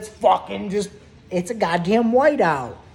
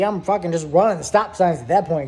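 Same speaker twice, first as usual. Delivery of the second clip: ordinary delivery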